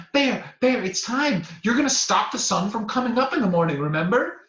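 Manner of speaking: high, loud, urgent little chipmunk voice; eager chipmunk. Acted. Chipmunk urgently waking the sleeping bear. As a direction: chirp at the bear to wake up.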